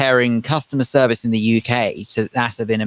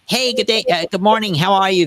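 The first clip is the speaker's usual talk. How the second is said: badly faked American accent, pitched higher; mock American greeting in a higher, put-on accent; dreadful American accent; 6 semitones higher than usual. Host imitates American shop staff greeting customers, dreadful American accent. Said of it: "it was a dreadful American accent".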